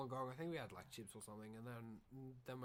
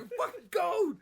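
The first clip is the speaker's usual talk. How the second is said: much higher, louder whiny brother voice; imitating brother; 12 dB louder than usual. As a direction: hammer home how cold he claims to be, pitched way up. Imitating brother, escalating retelling.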